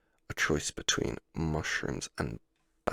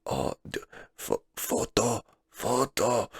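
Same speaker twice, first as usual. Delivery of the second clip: put-on higher-pitched, drawn-out Japanese-accented English; hammed-up Japanese accent, halting then high; imitated Japanese waitress accent; 7 semitones higher, slower than usual. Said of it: "I'm doing the accent".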